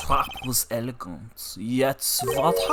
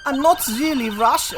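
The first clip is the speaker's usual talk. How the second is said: put-on Russian accent; 4 dB louder than usual. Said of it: "try doing a Russian accent".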